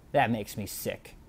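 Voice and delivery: quiet repeat of the disgust; disgusted mutter. Real, not acted. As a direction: say it under your breath in disgust.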